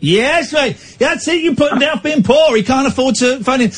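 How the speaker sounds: high, hammed-up triumph; mock cheering. Host cheering young caller's comeback, mock triumph. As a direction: egg him on in a high voice.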